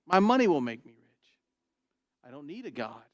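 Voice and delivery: ordinary talk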